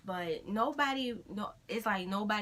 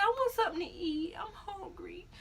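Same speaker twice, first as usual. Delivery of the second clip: high, whiny mock weeping; re-enacted tearful whine; imitated crying; 12 semitones higher than usual. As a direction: whimper it, fading off. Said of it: "I burst out and started crying".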